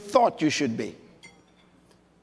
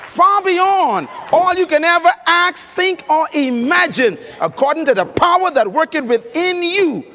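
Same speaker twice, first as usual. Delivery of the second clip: preaching scripture; 5 semitones higher than usual. Acted. Preacher reciting Ephesians to the crowd, slow and soaring. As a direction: lift each phrase of the verse slowly like a preacher. Reciting Bible verse with force.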